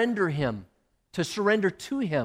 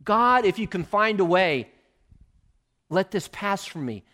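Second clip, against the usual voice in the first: loud, higher plea; crying out to God; 4 semitones higher, 6 dB louder than usual. Retold or imitated. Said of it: "crying out to God".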